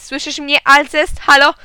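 high, loud phone check; shouting. A narrator's reading. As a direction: shout to check you are heard. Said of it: "krzyknąłem". (głośne, wysokie wołanie)

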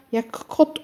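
usual speaking voice

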